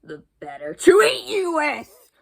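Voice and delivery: loud, sudden wolf snap; snapping wolf. Acted. Wolf springing at the girl, loud snap. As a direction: bite the words off as he lunges. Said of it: "snapped the wolf".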